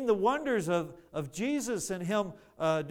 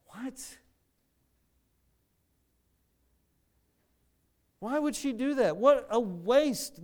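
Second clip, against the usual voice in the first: disgusted disciples; 5 semitones higher than usual. Retold or imitated. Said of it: "the ones that have lived with him for three years going".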